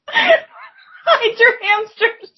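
high, very slow laughing cry. Real, not acted. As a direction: squeal it through laughter. Laughing meme riff.

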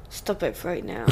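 low, hushed retold order; quiet warning. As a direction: say it softly under your breath, as a restrained warning.

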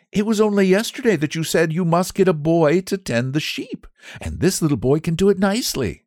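put-on high, light young woman's voice; a kind young lady explaining; young lady, pleasant. Daughter explaining the boy's duties, pleasant.

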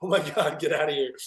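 half-laughing shriek of disgust; mock scream.

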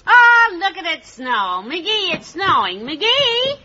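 high, slow, delighted calling out; a wife marvelling at the first snowfall, calling her husband; excited cry. Acted. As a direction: call him over, thrilled by the snow. Wife sees snow from window.

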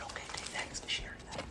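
quick hushed reply; whispering back. Retold or imitated. Rabbi whispering back to the confiding mother. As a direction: whisper fast, playing along.

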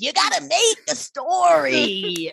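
high, slow, drawn-out mentor voice; mentor impression. Mentor demanding pages from Dan, high drawn-out caricature. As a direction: stretch each demand in a high mock voice. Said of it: "what the voice was".

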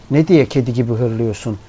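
plain reading voice

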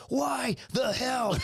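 high, drawn-out outraged demand; mock outraged screech; retold furious girlfriend. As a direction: mimic an angry girlfriend, stretching each word. Girlfriend confronts him on phone.

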